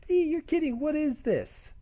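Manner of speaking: put-on very high girlish disbelief; acted shocked squeal. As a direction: squeal in mock shock at yourself. Heroine shocked at her transformation.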